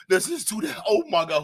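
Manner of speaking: ordinary talk, nothing marked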